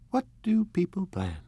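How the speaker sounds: high, lost little plea; helpless plea. Acted. Daisy to Nick, helplessly asking what to plan. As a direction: ask like a helpless girl. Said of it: "turned to me helplessly".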